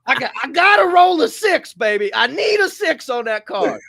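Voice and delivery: high, loud, begging for luck; high excited plea for a lucky six; gambler's plea. Retold or imitated. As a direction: beg like rolling dice at a casino.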